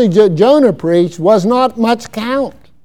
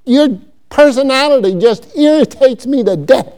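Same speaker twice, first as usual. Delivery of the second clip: angry Jonah; 6 semitones higher, 4 dB louder than usual. Acted. Preacher voicing Jonah's anger at God, raised and indignant. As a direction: whine at God like a furious prophet. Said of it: "Exceedingly angry, he said".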